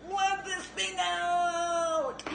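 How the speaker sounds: high, slow, stretched-out plea; body's mock urgent cry. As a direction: voice the body begging to push. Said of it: "but my body is like".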